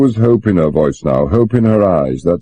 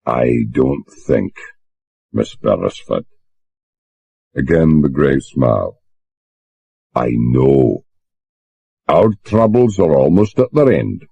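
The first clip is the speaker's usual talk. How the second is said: grave calm bosun; 3 semitones lower than usual. A narrator's reading. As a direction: speak with rock-like steadiness. Bosun voiced, slow calm.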